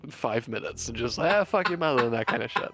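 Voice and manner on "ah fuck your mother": mock jeer, higher and louder; ball-busting jeer. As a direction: toss it out as teasing abuse. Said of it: "busting everybody's balls".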